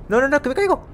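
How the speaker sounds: sudden high alarmed cry. Real, not acted. Player about to fall off the ledge, sudden high alarm. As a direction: yelp it out, afraid of falling, pitched high.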